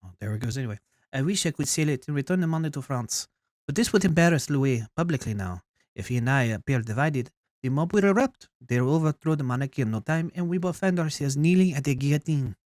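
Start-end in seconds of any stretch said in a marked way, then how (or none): 1.1-12.6 hammed-up French queen; bad French accent. Queen blamed for the country's debt, bad French accent. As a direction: read it in an amateur French accent. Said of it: "probably a really bad French accent".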